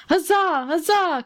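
high storybook hurrah; a court crying out in acclaim, pitched high; crowd's cheer. A narrator's reading. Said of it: "cried the court".

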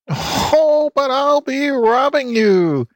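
put-on high, slow cackling old hen voice; cackling hen lady. A narrator's reading. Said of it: "cackled Missus Cluck Cluck".

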